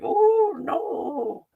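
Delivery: high, slow squeaky cry of the doomed parasites; mock parasite panic. Vlogger jokes about garlic killing parasites in a high squeaky plea. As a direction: voice the parasites begging, tiny and high.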